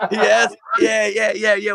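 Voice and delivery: laughing answer. Real, not acted.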